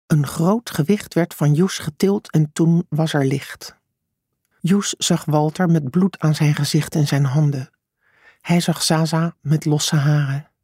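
ordinary delivery, nothing marked

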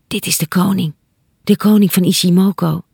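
plain reading voice

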